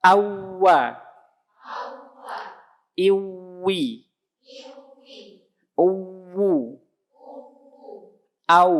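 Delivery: Awwa, Iwi, Uwu with the lips pushed forward before the sound; lips pursed. Shown as a demonstration. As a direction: round the lips first, then voice Awwa.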